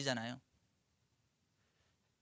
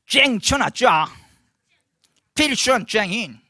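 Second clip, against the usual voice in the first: slow, higher, louder fake Chinese pronunciation; mock Chinese; 5 semitones higher, 11 dB louder, much slower than usual. Shown as a demonstration. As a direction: imitate how it sounds in Chinese. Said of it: "제가 그냥 중국어 흉내내 본 거예요".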